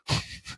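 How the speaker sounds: a quick real laugh; short laugh. Real, not acted. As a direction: laugh it off lightly. Laughing at the story.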